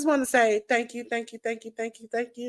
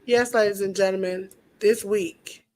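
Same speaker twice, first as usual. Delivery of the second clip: ordinary delivery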